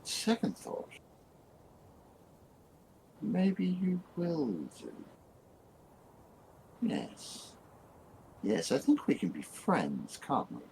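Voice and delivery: high, slow creeping voice; creepy high voice. Acted. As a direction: coax them slowly in a high, eerie voice. GM voices creature in darkness.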